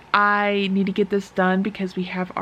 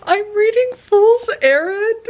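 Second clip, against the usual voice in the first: crying; 9 semitones higher than usual. Real, not acted. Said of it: "I'm already crying".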